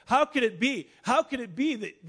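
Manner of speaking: baffled Nathanael. Acted. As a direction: ask it in loud bewilderment. Nathanael acted, baffled by Jesus.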